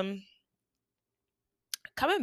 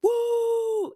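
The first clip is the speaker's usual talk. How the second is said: high, ringing woo; hype man whoop. Retold or imitated. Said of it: "he kept on going".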